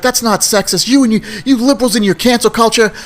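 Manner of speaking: high-pitched mock indignation; argumentative retort. Man arguing back at a woman over dinner, mock indignant. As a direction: imitate a heated comeback. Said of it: "getting into a little bit of a fight".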